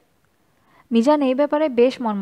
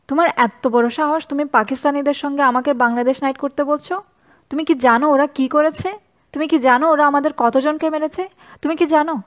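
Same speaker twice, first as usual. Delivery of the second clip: higher and louder, hammering each তুমি কি জানো; furious, demanding answers; heated outburst; 3 semitones higher, 4 dB louder than usual. Acted. Mizan confronting the foreign student advisor, angry. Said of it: "তুমি এত উত্তেজিত হচ্ছ কেন".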